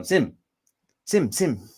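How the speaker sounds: put-on New Zealand accent. Mimicking the New Zealand housemate calling Sam in her New Zealand accent. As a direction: call Sim, Sim, Sim like the Kiwi housemate, a touch up in pitch. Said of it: "just the way she would talk".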